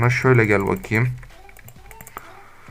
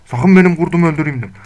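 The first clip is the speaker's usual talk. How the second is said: higher and louder, a stern warning; 5 semitones higher, 5 dB louder than usual. Real, not acted. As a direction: shout it at the wolf. Repeated warning to the wolf. (yüksek sesle sert uyarı)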